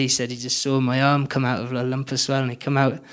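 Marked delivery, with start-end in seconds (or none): none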